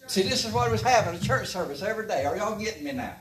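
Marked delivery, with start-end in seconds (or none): none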